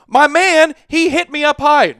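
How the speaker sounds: loud, high mock protest; mock Brady complaint. Host retells Brady yelling at the referee, loud. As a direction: play Brady pleading with the ref. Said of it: "He's like".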